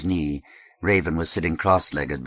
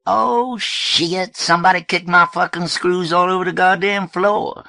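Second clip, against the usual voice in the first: put-on higher, louder griping young man; loud, high-pitched irritated cursing; Raven cursing; 13 semitones higher, 7 dB louder than usual. Raven griping about his kicked screws, high and loud.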